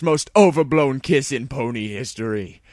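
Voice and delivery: plain reading voice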